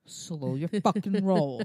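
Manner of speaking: slow, loud mom reprimand; mock scolding. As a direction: put the cheeky kid in his place, word by word. Mom retells scolding cheeky son.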